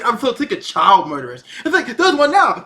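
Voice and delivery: usual speaking voice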